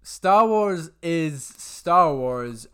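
slow, loud, pompous declaration; grandiose. Real, not acted. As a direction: proclaim it like a grand truth. Grand slow counter-argument opener.

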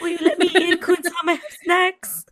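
high, loud, laughing plea; mock begging. [name] stuck outside Kun-sam's door with snacks, comically pleading. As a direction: play a hapless suitor begging to be let in, amused.

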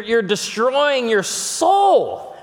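pleading preach. Real, not acted. Preacher pressing the point.

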